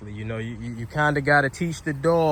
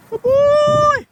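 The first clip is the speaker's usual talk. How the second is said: high, loud praise for the dog; squeaky praising a dog; 9 dB louder than usual. Real, not acted. Praising dog after a catch.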